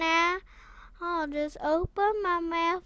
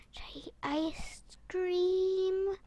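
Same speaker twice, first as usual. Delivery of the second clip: whisper; 8 dB quieter than usual. Real, not acted. Musing about food.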